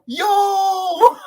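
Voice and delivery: long, very high, loud re-enacted holler; amazed shout. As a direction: cry out in hammed-up disbelief. Re-enacts his amazed reaction.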